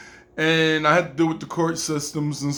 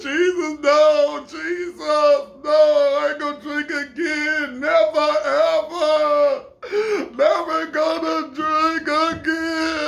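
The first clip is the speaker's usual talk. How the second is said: high, tearful pleading to Jesus, slowing at the end; begging through tears never to drink; sobbing plea; 9 semitones higher, slower than usual. Retold or imitated. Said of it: "I was crying".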